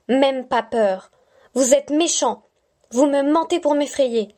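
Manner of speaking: put-on defiant little girl. Stella taunting the unseen voice, defiant childlike tone. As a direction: dare the voice to show itself.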